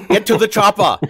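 loud, high Austrian action-hero bark; Arnold accent. Acted. Buzzing in during the game, shouted Austrian accent. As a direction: yell it like Arnold in Predator. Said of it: "saying anything in an Arnold accent".

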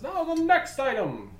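high, loud announcer call; projected stage announcement; auctioneer announcing. Acted. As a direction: call out to the whole hall.

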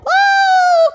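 high loud "Oh"; excited gasp. A narrator's reading. Narrator celebrating the girl's shot, loud high gasp. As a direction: gasp with delight. Ball went in a hole.

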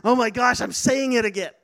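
loud, startled exclamation; astonished realization. Retold or imitated. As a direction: catch yourself in loud amazement. Retold surprise at own words.

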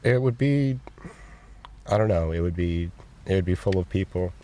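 halting, choked-up answer with long pauses; emotional. Real, not acted. Interviewee picturing a fantasy pizza shop, real emotion welling up. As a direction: search haltingly for the words, getting emotional. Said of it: "I'm getting a little emotional".

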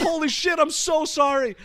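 high, panicked apology shout. Retold or imitated. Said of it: "she heard me scream that".

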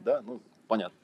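normal talking voice

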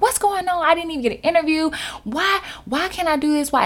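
high, fast desperate pleading; frustrated outcry. Retold or imitated. Worker crying out to God in frustration, high pleading. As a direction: cry out to the sky. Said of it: "I'm literally frustrated, screaming".

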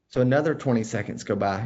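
normal talking voice

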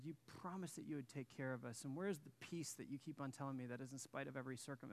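ordinary talk

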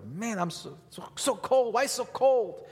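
put-on high, loud whiny boy griping about cold; high whiny complaining about the cold; childhood whining. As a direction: whine like a kid in the cold. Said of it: "I was complaining about the weather".

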